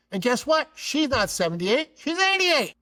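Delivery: high voice climbing to a loud peak; incredulous reveal. Retold or imitated. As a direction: act out a scandalised dad's punchline. Dad's outraged age revelation.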